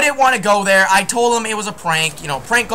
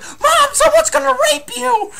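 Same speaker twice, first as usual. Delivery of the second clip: much higher mock whine through laughter; mocking the scared kid; 4 dB louder than usual. Retelling the prank call victim yelling to his mom, a high mock wail. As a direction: squeal it like a panicked kid calling his mom. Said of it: "he's like".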